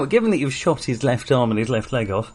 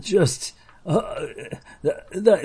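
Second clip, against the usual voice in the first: stammering plea; 6 semitones higher than usual. Acted. Raider pleading, stammering.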